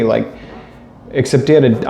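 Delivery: usual speaking voice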